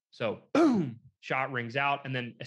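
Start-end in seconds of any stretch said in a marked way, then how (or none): 0.5-1.0 sudden high, louder boom; imitated gunshot boom. Storyteller mimicking the young hunter's shot, explosive boom. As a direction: punch out a rifle blast.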